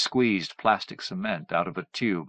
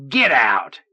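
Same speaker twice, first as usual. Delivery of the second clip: louder angry order; 3 semitones higher, 6 dB louder than usual. A narrator's reading. Son ordering his mother out, angry and louder. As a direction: throw her out in anger. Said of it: "he said angrily".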